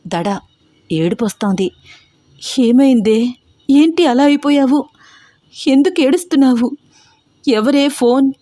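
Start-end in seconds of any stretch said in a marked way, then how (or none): 2.6-8.3 frightened questions. Acted. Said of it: "భయంగా రూపని చూస్తూ".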